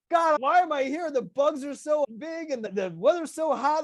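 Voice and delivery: high-pitched exasperated woman; mock complaining. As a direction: whine it high, imitating a fed-up newcomer. Retold woman complains about Texas.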